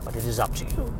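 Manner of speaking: lightly voiced solemn Stewart narration; Patrick Stewart. Stewart-style trailer voice-over for John Carter of Mars, understated. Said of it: "So Patrick Stewart and John Carter of Mars".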